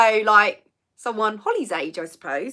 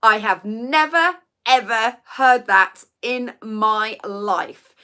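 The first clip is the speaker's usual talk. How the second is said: slow, louder, stressing never; emphatic disbelief; 4 dB louder, much slower than usual. Real, not acted. Telling viewers she never heard it, slow and emphatic. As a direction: lean on never, ever.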